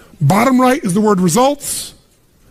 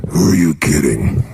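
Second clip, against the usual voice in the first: much lower, slow, sneering inner voice; doubting inner voice; slower than usual. Retold or imitated. The inner critic mocking your big plans, low and slow. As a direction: drop into the voice of self-doubt. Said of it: "this voice in your head goes".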